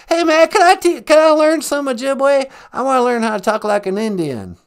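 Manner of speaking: loud eager Bush voice; hammed-up Bush; George W. Bush impression. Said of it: "George W. Bush".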